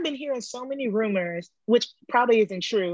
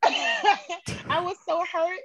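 laughing; 9 semitones higher, much slower than usual. Real, not acted. Laughing while recalling the hurt.

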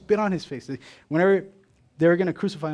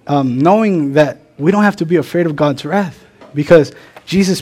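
much louder, ringing sermon voice; full-force preaching; 13 dB louder than usual. Acted. Pastor to congregation, loud fervent preaching. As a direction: preach it out to the back row.

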